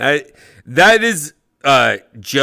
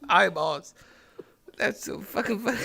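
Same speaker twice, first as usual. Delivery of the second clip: ordinary talk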